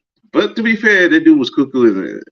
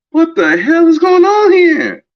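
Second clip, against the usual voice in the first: shocked shout; 9 semitones higher than usual. Retold or imitated. Shocked at video of players humping.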